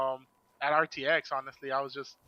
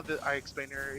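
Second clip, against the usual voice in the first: usual speaking voice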